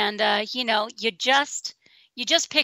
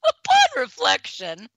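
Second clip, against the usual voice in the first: higher, slow sarcastic repeat; mocking; 9 semitones higher, 4 dB louder, slower than usual. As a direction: savor the silly phrase.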